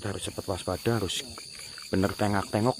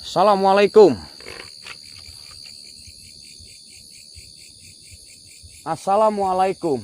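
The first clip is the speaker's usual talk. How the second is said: calling out to spirits; 7 semitones lower, 8 dB louder than usual. Real, not acted. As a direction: throw the greeting out into the dark. Greeting the spirits.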